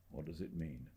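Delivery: quiet, low and uneasy. A narrator's reading. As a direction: murmur it, half to himself. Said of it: "He spoke in a low voice".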